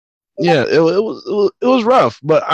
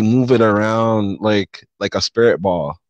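ordinary talk

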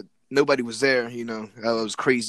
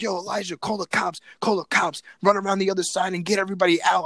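fast, higher, pushing commands; urgent orders; 5 semitones higher, 3 dB louder than usual. Retold or imitated. Telling his friend to call the cops, fast and urgent. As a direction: bark the plan to his friend.

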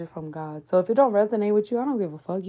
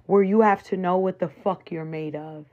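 ordinary talk, nothing marked

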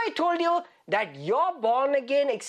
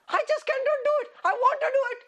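high-pitched, pleading protest; hammed-up helplessness, pitch jumping way up; mock exasperation; 13 semitones higher, faster than usual. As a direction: wail it in playful frustration. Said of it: "they're just mad".

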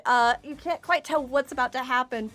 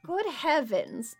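slow, mild surprise; 3 semitones higher, much slower than usual. Acted. GM voices Oberon.

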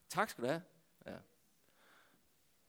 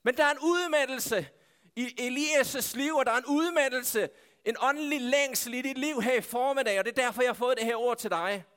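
raised, emphatic sermon delivery; impassioned preaching; 6 semitones higher than usual. Real, not acted. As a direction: drive the message home with force.

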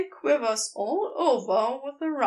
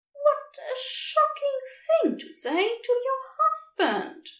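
high, mock-scandalised glee; gleeful; 11 semitones higher than usual. A narrator's reading. Aziraphale mock-offended at Crowley, high gleeful voice. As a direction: pretend to be shocked while loving it. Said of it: "Aziraphale says gleefully".

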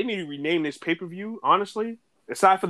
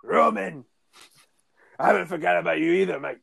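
Drew McIntyre impression; 3 semitones higher than usual. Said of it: "That's literally how he talked".

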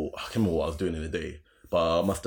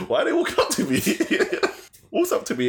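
laughing. Real, not acted. Laughing at the memory.

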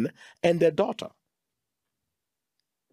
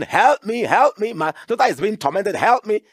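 high, loud pleading cry; a mother crying out for her child; desperate cry; 7 semitones higher, 5 dB louder than usual. Retold or imitated. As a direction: beg for help, raised and urgent. Woman's desperate cry retold.

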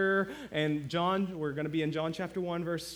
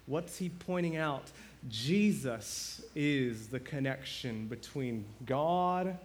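ordinary delivery

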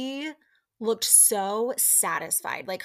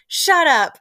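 loud retold outburst; 7 semitones higher, 12 dB louder than usual. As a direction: imitate a loud, high "shut up". Retelling Olivia's sharp comeback.

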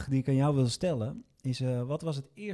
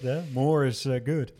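ordinary delivery

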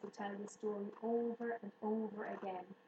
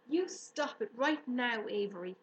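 crying out; 2 semitones higher, 6 dB louder than usual. A narrator's reading. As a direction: cry out to stop him. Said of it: "cried Fern".

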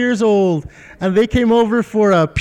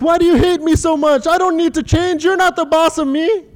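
put-on high whiny child voice; petulant child talking back to parents; mock bratty kid; 8 semitones higher than usual. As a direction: whine it like a defiant little kid. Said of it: "and I'm like".

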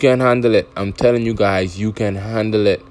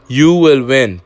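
"You will win" pushed louder and higher, landing hard on "You"; louder repeat; 4 semitones higher, 5 dB louder, slower than usual. Real, not acted. Repeating to listeners "You" will win, louder. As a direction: hit "You" harder the second time.

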